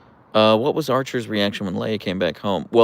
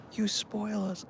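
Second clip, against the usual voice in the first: higher, softer doting coo; 12 semitones higher, 10 dB quieter, slower than usual. Real, not acted. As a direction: coo it tenderly at the dog. Repeated, sweeter.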